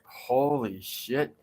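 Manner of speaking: low, slow, soft disbelief; hushed shock. Retold or imitated. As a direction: breathe it out quietly, stunned and slow. Retold horror at lady's scream.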